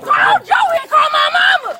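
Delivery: high, loud, slow desperate shout. Real, not acted. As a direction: yell it out, drawn out and frantic. Being pinned, yelling for help.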